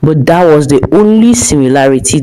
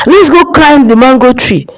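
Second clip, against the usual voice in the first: put-on higher-pitched, mildly eager child's call; playful invitation; 7 semitones higher than usual. A narrator's reading. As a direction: call a friend off to play, pitched up. Child inviting friend to play.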